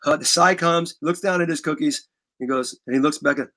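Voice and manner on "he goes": ordinary talk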